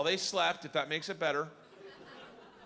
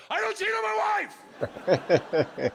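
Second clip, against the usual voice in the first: very high, drawn-out holler; full-volume, stretched-out proclamation; public announcement shout; 8 dB louder, much slower than usual. Acted. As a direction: bellow it slowly for everyone to hear. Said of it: "in front of everyone and go".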